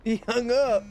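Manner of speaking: can't believe he hung up; surprised disbelief. Real, not acted. Gamer amazed the AI hung up on him, stunned.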